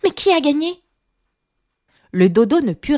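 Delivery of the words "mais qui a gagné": mildly breathless, pitched up; high, puffed-out group question; panting question. A narrator's reading. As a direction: ask excitedly as if winded. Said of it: "en demandant d'une voix haletante".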